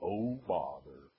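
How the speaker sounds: low, slow, gloomy donkey sigh; Eeyore's oh bother. Retold or imitated. Pastor quoting Eeyore's gloomy catchphrase. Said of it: "Much like Pooh and Eeyore always said".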